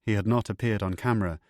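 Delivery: plain reading voice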